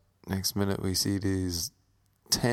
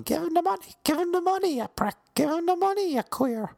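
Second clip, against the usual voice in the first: put-on much higher squeaky kid voices jeering; jeering little kids. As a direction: screech like a pack of little brats. Gang of kids egging on.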